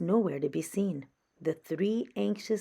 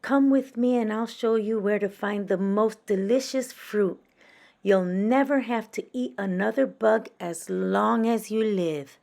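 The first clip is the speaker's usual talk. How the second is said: loving mother; 4 semitones higher, 6 dB louder than usual. A narrator's reading. Mother bat comforts.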